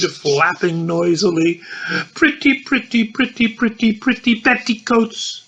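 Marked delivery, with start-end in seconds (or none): 2.1-5.4 a high, lilting parrot-like cry; a hint of a chirping bird; lightly voiced bird call. A narrator's reading. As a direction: sing it out like a cooing bird.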